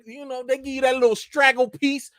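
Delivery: normal talking voice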